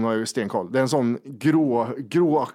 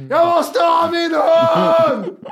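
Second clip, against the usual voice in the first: long, drawn-out high mock scream; 13 semitones higher, 7 dB louder, much slower than usual. Owner running back and forth for her dog, imitated desperate scream. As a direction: wail it at full volume, imitating panic. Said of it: "Vad fan skriker hon?".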